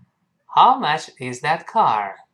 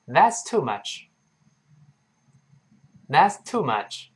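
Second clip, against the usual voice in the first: model sentence. Shown as a demonstration. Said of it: "我们一块儿来一遍".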